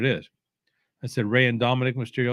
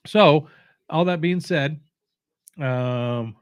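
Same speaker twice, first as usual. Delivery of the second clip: usual speaking voice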